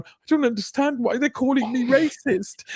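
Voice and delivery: high, bewildered mock plea; confused marchers. Marchers protesting to the crowd, high bewildered mock voice. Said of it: "a load of people there going".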